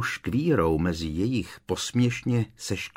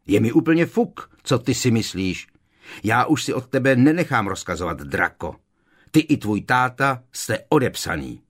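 loud, high defiant outburst; raised, contemptuous rant; open fury; 4 semitones higher, 6 dB louder than usual. A narrator's reading. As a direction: lash out angrily at a former boss. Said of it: "s netajeným vztekem".

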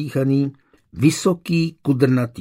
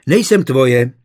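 louder, sharp protest; indignant cry; 7 dB louder than usual. A narrator's reading. Young woman cries out at her suitor, indignant. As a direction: cry out in refusal. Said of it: "vykřikla Janička".

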